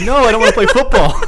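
put-on high, loud whiny kid laughing through it; mock the kid's whine while cracking up; laughing kid impression; imitating kid, laughing. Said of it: "He's like".

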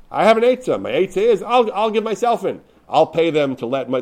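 high-pitched, eager retold outburst; excited. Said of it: "he excitedly said".

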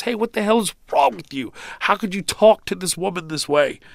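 pitched well up, mock indignant telling-off; upset officer scolding. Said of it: "He was very upset".

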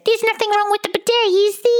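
very high, quick Australian squeal; bad Australian accent. Shown as a demonstration. Warming into the accent with a phrase, squeaky Australian. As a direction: do a badly faked, high Australian. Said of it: "if I was doing Australian".

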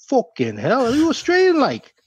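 imitated Liverpool accent with a soaring, incredulous lilt; Scouse accent. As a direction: do a Scouser, swooping high mid-question. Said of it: "this Scouser hobbled over and said".

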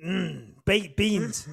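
very slow, relishing baked beans; savouring. Acted. Savouring the words baked beans for his co-hosts, very slow.

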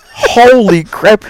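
loud, high, drawn-out exclamation; startled. Retold or imitated. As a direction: stretch it out in retold shock. Startled by the bird, retold.

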